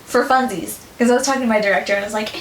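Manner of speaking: ordinary talk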